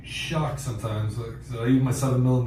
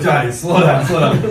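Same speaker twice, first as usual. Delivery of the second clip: calling out; 6 semitones higher, 10 dB louder than usual. Retold or imitated. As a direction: shout after a friend rushing ahead. Calling after friend running ahead.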